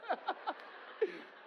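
a sudden high laugh; high burst of laughter. Real, not acted. Laughing at son's honesty.

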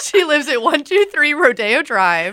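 mock reveal. Joking fake address.

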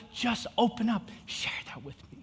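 gentle whisper; whispered invitation. Acted. God speaking gently, invitation.

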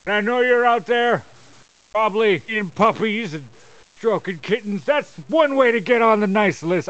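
wrestling promo. Acted. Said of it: "you just cut a promo".

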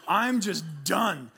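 ordinary talk

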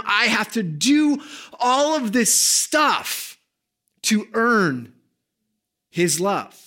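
building loud high preach; preaching at full force. Acted.

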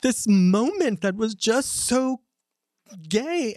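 high, slow, savoring each word; gleefully drawn-out gossip; scandalized delight. Retold or imitated. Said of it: "And she's like".

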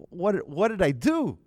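high, loud, slow mock dismay; horrified realization. Man realizing whom he slapped on the train, horrified. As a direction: wail it in dawning horror, acted.

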